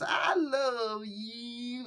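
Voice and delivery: high, very slow crooning preacher love; Brother Love voice. Retold or imitated. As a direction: stretch it out like a syrupy TV preacher. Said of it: "the Bruce Pritchard brother love voice".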